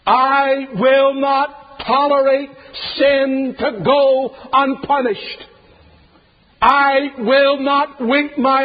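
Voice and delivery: slow, high, loud divine decree; voice of God. Acted. God declaring his law, slow and loud. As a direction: thunder it as God refusing to bend. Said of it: "God is saying, in essence".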